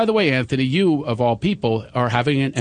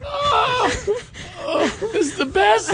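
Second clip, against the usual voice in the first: very high squeal of delight; words squeezed out through laughing; breaking up, shrieky with laughter; helpless laughter; slower than usual. Real, not acted. Host overcome with laughter.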